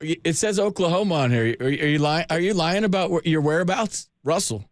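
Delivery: normal talking voice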